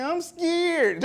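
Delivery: high, imitated tearful whimper; mock crying. Imitating the woman crying to Eddie, high mock tearful. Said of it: "She started crying and saying".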